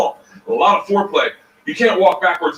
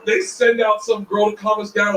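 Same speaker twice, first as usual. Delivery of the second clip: ordinary talk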